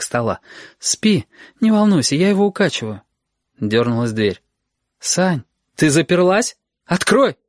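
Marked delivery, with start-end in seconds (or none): 0.8-3.0 натянуто спокойно. Acted. Сашка успокаивает маму. 5.0-7.4 высоко, медленно, с нажимом; требуй через дверь; встревоженная мама. Acted.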